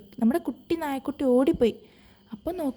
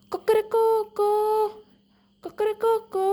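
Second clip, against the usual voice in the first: rooster crow; 8 semitones higher than usual. Retold or imitated. Rooster shows the puppy his only call, high crowing. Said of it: "എനിക്കിങ്ങനെ കരയാനേ അറിയുള്ളൂ".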